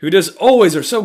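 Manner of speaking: sudden high, loud outburst; exasperated yell. Real, not acted. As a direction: erupt in exasperation. Rant erupts about neighbors.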